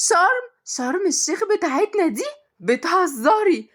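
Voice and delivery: high-pitched, astonished questions; thrilled shock; stunned disbelief. Acted. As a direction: squeal it as if you can't believe it. Stunned to learn job is in Sharm.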